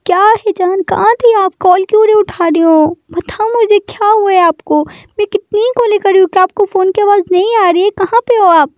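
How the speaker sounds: high, pleading worry over the phone; anxious worried partner. Acted. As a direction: keep pressing her with anxious questions. Girlfriend anxiously calling her partner. (घबराई हुई, ऊँची आवाज़ में परेशान सवाल)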